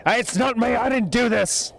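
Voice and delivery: high, frightened goblin denial; scared goblin. Acted. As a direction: stammer out a panicked denial. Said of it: "goblin still denying".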